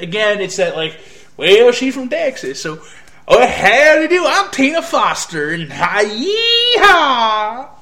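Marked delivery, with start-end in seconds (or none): 1.4-7.7 high, loud hammed-up Texas drawl; imitating a cartoonish Texan accent; mock Texas accent. Reviewer mimicking the character's Texas accent, loud and high. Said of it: "Wendy Lee is hilarious in her accent".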